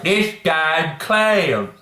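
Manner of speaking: mock Texas drawl. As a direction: drag the words out like a sneering Texan. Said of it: "That stupid Texas drawl that he has".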